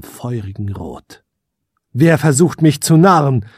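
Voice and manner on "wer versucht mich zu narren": raised, louder demand into the darkness; prince's defiant call; challenging shout. A narrator's reading. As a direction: call out to an unseen foe. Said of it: "rief der Prinz in die Dunkelheit hinein".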